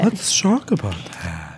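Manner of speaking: low, slow mock-serious echo.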